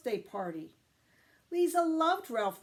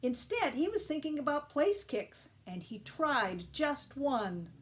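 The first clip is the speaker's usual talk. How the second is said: plain reading voice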